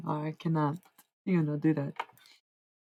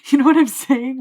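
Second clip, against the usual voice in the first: laughing; 9 semitones higher, 13 dB louder than usual. Real, not acted. Laughing at own accent switch.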